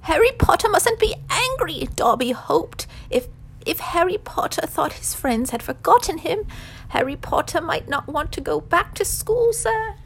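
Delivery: very high, loud, anxious elf; anxious Dobby. A narrator's reading. House-elf explains himself to Harry, anxious and high. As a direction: squeak it out as a pleading elf. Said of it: "blinked anxiously up at Harry".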